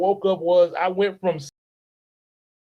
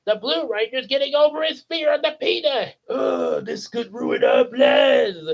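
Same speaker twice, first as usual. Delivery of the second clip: frustrated Rita; 7 semitones higher than usual. Retold or imitated. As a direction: rage that the plan is failing.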